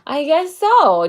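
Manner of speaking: high, loud, slow coy I guess so; coy agreement. Real, not acted. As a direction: play it modest but pleased, drawn out. Answering a viewer's compliment.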